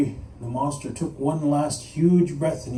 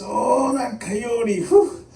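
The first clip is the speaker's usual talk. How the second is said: slow, higher groan ending in a puff; pained groaning; 6 semitones higher, much slower than usual. Acted. Monster gripping his chest at Coyote's fire, pained groan. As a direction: groan it out as heartburn hits.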